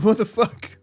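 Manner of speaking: high, loud what the fuck; startled loud outburst; shocked exclamation. Real, not acted. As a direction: blurt it out in disbelief. Reacting to a shocking picture.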